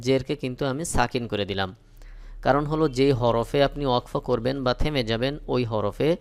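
ordinary delivery

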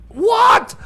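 shocked disbelief. Acted. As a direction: gasp it out like you can't believe it. Reads shocking song title.

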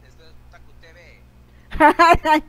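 big show-host announcement, slow; announcer voice. Acted. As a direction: announce the channel name.

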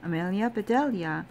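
usual speaking voice

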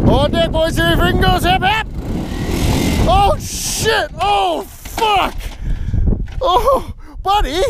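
high-pitched excited hollering at the tractor; squealing play-by-play as it takes off; excited cheering. Real, not acted.

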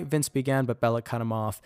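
usual speaking voice

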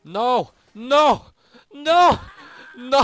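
escalating panicked yells; rising screams. Real, not acted. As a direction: scream them, climbing each time. Repeated screams at game.

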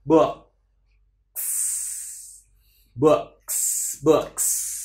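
"Books" stopped at k, then a long hissed s; held breath into S. Shown as a demonstration. Teacher demonstrating breath control, "Books" with long final hiss. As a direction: hold the breath after the vowel of "Books", slide into sss. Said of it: "giữ hơi xong chuyển mà không rơi cái hơi xuống luôn".

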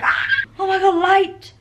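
sudden high, loud joy at the light; delighted squeal at the sun; excited cry at the sun. Retold or imitated. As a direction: gasp with relief as the sunlight appears. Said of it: "I'm like".

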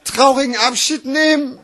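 phrases climbing high in pitch; dramatic slam recital. Acted. Slam poet vowing never to look back, dramatic recital. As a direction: declaim it with rising resolve.

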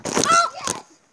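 loud gleeful yell; excited shout. Real, not acted. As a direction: cheer it out loud. Celebrating a hit on friend.

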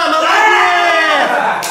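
slow, drawn-out shouted praise; gym bro. Real, not acted. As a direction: stretch out the praise. Praising a finished lift. (протяжный крик похвалы)

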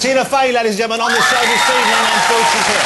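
high, loud shout over applause; excited showbiz holler; rousing host announcement. Real, not acted. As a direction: whip up the crowd for the guest. Host hails guest over applause.

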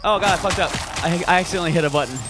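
loud flustered rush; panicked. Real, not acted. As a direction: blurt it out, fast and rattled. Panicked after mistake.

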